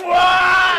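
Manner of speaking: high, loud held "waah"; full-volume retold whoop; mock hype yell. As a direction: imitate a crowd yelling into the phone. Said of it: "all fake hyping in the phone".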